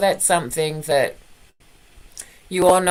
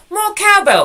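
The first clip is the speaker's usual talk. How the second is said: excited high cry; 11 semitones higher than usual. Acted. Punchline cry for cowbell.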